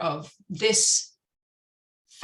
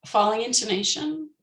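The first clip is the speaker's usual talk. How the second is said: usual speaking voice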